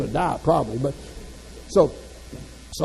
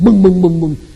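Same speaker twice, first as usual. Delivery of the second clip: booming thumps, much louder and landing hard; each boom a bump on the stairs; loud mock bumps; 3 semitones higher, 14 dB louder than usual. Shown as a demonstration. Preacher showing the crowd how you slide down the steps, loud booming.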